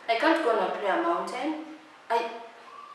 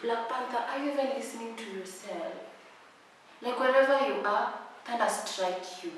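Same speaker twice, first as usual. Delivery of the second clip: stunned hush; 11 dB quieter than usual. Real, not acted. Stunned reply to proposal.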